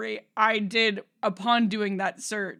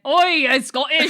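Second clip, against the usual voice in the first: loud, slow, botched Scottish accent; bad Scottish accent; 5 semitones higher, 9 dB louder than usual. Shown as a demonstration. Host showing they can't do it, bad Scottish accent. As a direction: attempt Scottish and do it badly. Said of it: "I can't do a Scottish accent".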